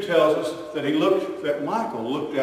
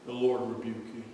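low firm rebuke; 5 semitones lower, 6 dB quieter than usual. Acted. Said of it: "and say".

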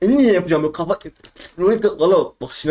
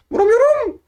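high frustrated squeal. Real, not acted. Gamer missing his shots, high frustrated squeal. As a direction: whine it way up high.

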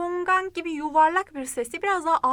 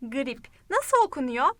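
normal talking voice

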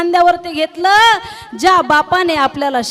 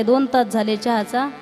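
ordinary talk, nothing marked

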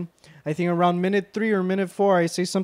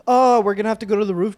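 alarmed; 5 semitones higher, 7 dB louder, faster than usual. Real, not acted. Alarmed about coming fight.

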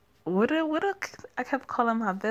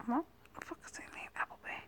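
whisper; 6 semitones higher, 16 dB quieter, much faster than usual. Real, not acted. Aside while reading game names.

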